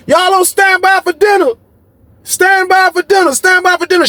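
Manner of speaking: guard lady hollering the dinner order; guard calling out. Retold or imitated.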